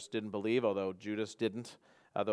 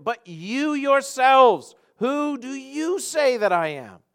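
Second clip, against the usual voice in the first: high, loud, drawn-out YOURSELVES; very emphatic question; 13 semitones higher, 11 dB louder, much slower than usual. Acted. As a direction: press hard and slowly on "you yourselves". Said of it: "it's very emphatic".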